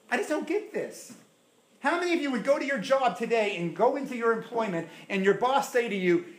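ordinary delivery

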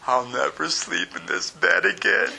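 laughing through a mock melancholy line; half-laughing send-up of his gloomy younger self. Preacher mocking his pre-wedding gloom with a laugh. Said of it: "how melancholy I was".

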